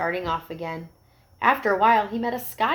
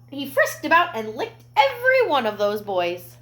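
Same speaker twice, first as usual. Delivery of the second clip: ordinary delivery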